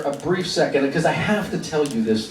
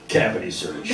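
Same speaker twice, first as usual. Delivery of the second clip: hushed, breathy mock guard aside; mock whisper; 7 semitones lower, 4 dB quieter than usual. Guard muttering at the weird guitarist, hushed. Guards imagined eyeing him.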